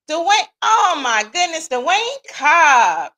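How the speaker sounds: high, slow and loud, lighting up at a name; delighted greeting. Real, not acted. Streamer spotting a friend's name in the chat, delighted.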